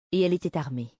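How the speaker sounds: ordinary delivery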